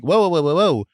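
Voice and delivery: loud rising whoas, fending off; alarmed whoa. Retold or imitated. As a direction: back off, whoa, whoa. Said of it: "I'm like".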